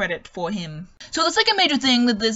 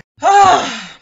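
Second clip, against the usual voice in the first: a long, loud, weary sigh of relief; heavy sigh; 3 semitones higher, 9 dB louder than usual. Real, not acted. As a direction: let all the air out, exhausted. Worn out after finishing the reading.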